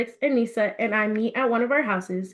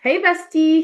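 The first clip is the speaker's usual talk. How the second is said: cheerful greeting; 6 semitones higher, 5 dB louder than usual. Acted. Friend greets warmly.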